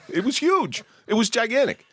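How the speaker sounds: high, incredulous exclaim; amazed exclamation. Real, not acted. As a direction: squeal it out in disbelief. High-pitched disbelief at size.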